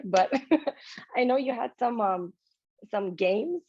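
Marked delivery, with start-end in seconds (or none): none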